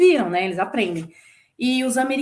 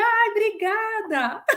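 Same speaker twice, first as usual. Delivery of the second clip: laughing thanks; 10 semitones higher, much slower than usual. Retold or imitated.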